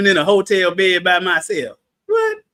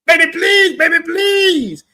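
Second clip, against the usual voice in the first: begging Paul; 9 semitones higher, slower than usual. Pretend. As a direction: beg like a desperate lover. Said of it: "Paul probably like".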